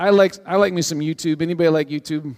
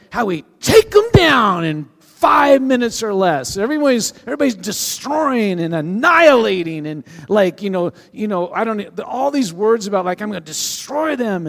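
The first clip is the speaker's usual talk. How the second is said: mock bravado; 7 semitones higher, 8 dB louder than usual. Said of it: "watch this video of".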